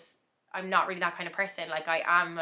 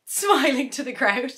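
laughing; 6 semitones higher, 6 dB louder than usual. Retold or imitated. Quoting her own overwhelmed thought.

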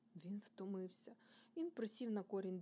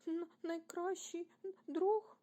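high, halting tearful question; sobbing; 8 semitones higher, 6 dB louder, slower than usual. A narrator's reading. Rasmus asks if they are best friends, sobbing. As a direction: stammer it through a sob, rising high at the end. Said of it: "і схлипнув".

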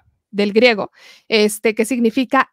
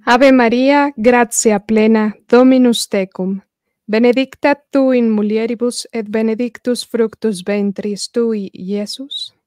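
louder, slow recited Hail Mary; Latin prayer; 5 dB louder, slower than usual. Real, not acted. Guest leading the first half of the Hail Mary, devout recitation. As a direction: pray it aloud with devotion.

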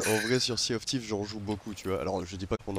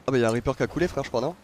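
ordinary delivery

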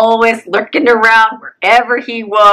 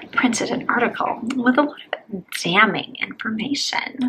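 whispering; 9 dB quieter than usual. Acted. Gossip reveal, hushed.

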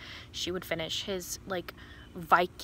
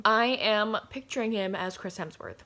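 usual speaking voice

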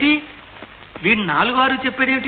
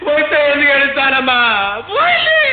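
wailing; 7 semitones higher than usual. Acted. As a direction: blubber it out like a broken man. Man wailing over lost jackpot.

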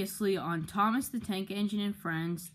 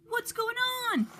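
alarmed. Acted. Lights suddenly go out.

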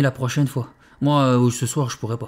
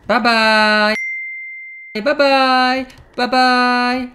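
mocking goodbye; 7 semitones higher, 4 dB louder, much slower than usual. Dismissing the ghost.

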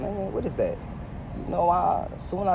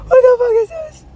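loud, extremely high squeal; mock wailing; 10 dB louder than usual. Driver appalled at the street, mock wailing. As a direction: squeal it in hammed-up despair.

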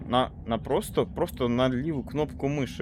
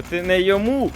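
shouting; 7 semitones higher, 8 dB louder than usual. Real, not acted. Shouting during game mishap.

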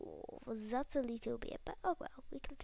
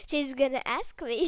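emphatic louder repeat; 10 dB louder than usual. Real, not acted. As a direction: blurt it out louder. Louder retake.

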